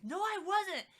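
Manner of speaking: put-on child's denial. Son denies it, retold.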